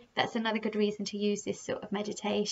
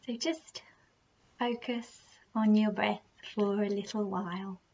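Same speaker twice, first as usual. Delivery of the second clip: slow, soft meditation voice with unhurried, drawn-out phrases; guided meditation; 4 dB quieter than usual. Acted. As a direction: gently guide the listener through their breathing, leaving long pauses.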